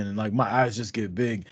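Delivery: usual speaking voice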